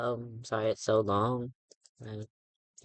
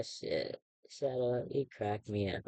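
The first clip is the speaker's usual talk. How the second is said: ordinary talk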